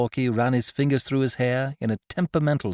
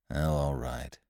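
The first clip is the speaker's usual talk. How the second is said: much lower and softer, reluctant; grudging; 11 semitones lower, 8 dB quieter, slower than usual. A narrator's reading. Corky gives in to Bertie, grudging. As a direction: give in without grace. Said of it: "Not cordially".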